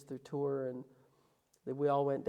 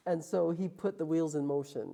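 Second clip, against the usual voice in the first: ordinary talk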